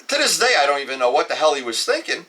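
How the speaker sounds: ordinary delivery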